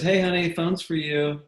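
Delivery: mom calling. Retold or imitated. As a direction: call out lightly as a mother would. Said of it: "and she goes".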